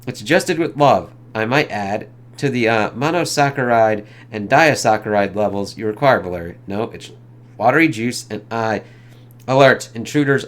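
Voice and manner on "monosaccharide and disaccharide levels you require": usual speaking voice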